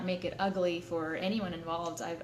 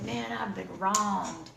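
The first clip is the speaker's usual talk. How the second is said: whiny self-pity; 3 semitones higher, 6 dB louder, slower than usual. Retold or imitated. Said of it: "getting ready to tell him, like".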